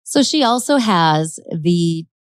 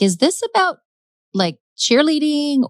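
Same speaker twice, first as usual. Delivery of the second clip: higher-pitched mock bewilderment; shocked; 9 semitones higher than usual. Imitating Verna questioning detectives, mildly shocked. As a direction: imitate a baffled, shocked mom. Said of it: "completely shocked when these detectives show up".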